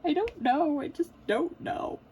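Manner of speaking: high, loud mock wail, slowing at the end; imitated sobbing. Crying wife answers puzzled husband, mock sobbing. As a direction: bawl helplessly, hammed-up. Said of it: "And I'm just sobbing".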